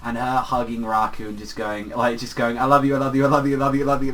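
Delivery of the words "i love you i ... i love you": louder, higher, run together in a string; gushing imitated declaration. Heroine hugging him and declaring love, gushing. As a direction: tumble the phrases out over each other.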